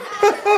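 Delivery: short high laugh. Real, not acted. [name] laughs at the host's joke, short laugh. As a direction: chuckle at the quip.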